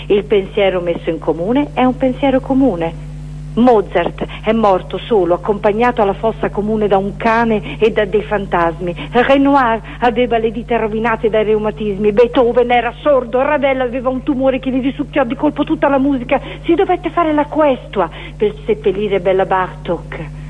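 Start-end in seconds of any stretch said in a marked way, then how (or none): none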